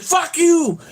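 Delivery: high-pitched mock holler; imitated angry yell. Retelling the Colonel cursing a driver, high imitated yell. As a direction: holler it high, as a retold curse. Repeats the Colonel's yelled curse.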